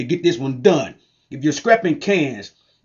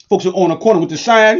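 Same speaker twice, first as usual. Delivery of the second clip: ordinary talk, nothing marked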